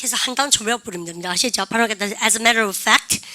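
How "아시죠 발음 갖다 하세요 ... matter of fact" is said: ordinary talk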